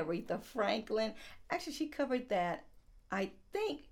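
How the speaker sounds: ordinary talk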